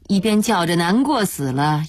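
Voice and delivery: plain reading voice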